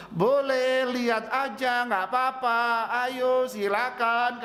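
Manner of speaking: high, slow, sweet-talking shopkeeper voice; shop attendant. Retold or imitated. Preacher imitating a mall shop girl inviting customers in, high and slow. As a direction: coax a customer into the store. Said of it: "kan gitu kan penjaga toko ya".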